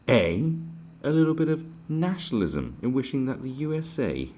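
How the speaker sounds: high, loud mock-posh parody of the commenter; mock pompous voice. Mocking the commenter's claim of xenophobia in a high snooty voice.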